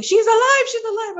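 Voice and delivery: high excited cry of a nurse; nurse shouting. Retold or imitated. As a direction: call out she's alive in shock. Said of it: "I heard her shouting".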